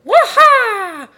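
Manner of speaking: loud high hero yell; battle cry. Acted. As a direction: shout a heroic battle cry.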